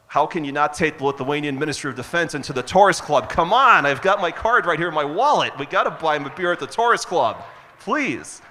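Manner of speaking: loud, high mock-outraged urging; playful insistence. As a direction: build to an exuberant, pleading peak. Acting out his eagerness to host.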